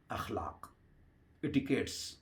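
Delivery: اخلاق breathed out in a whisper. Real, not acted. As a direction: let اخلاق fall to a whisper. Whispered word. (اخلاق سرگوشی میں)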